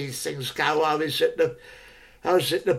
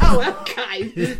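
resigned laugh; 6 semitones higher, 4 dB louder, slower than usual. Retold or imitated. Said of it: "shelling out".

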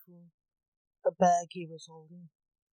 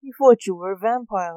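much louder, mock-amused jeer; laughed; 3 semitones higher, 15 dB louder than usual. A narrator's reading. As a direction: call it out loudly in mock amusement. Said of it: "laughed Max".

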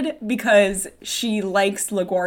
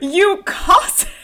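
high, drawn-out theatrical insult; hammed-up indignant sweetheart; mock outrage; 10 semitones higher, 4 dB louder, slower than usual. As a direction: hurl it in playful indignation. [name] pounding Floyd in play.

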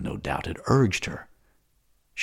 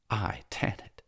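much softer, murmured to herself; 3 semitones higher, 7 dB quieter than usual. Acted. Salambo musing alone on being Tanit, much softer. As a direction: say it inwardly, barely aloud. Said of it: "said Salambo to herself".

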